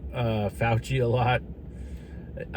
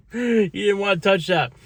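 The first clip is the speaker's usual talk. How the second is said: laughing; 9 semitones higher than usual. Real, not acted. Laughing at Joe avoiding it.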